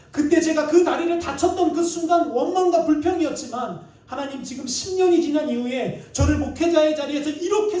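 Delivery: loud, high-pitched outpouring to God; impassioned retold prayer at the pulpit; fervent prayer. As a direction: cry out in prayer with full force. Acted fervent prayer of realization.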